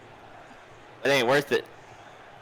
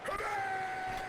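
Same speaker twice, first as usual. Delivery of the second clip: high squealing laugh; shrieking laugh; 14 dB quieter than usual. Real, not acted.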